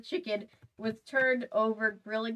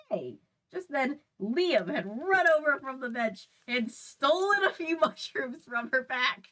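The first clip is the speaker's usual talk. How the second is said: usual speaking voice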